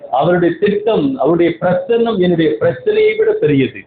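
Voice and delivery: normal talking voice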